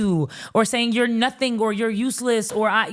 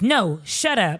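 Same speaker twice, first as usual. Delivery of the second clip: ordinary talk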